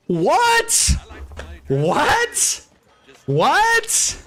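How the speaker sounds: high-pitched yelled disbelief, one word at a time; incredulous yell. Acted. Man floored by his date's answer, incredulous yell. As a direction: yelp it out far above normal pitch. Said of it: "I'm sorry for yelling".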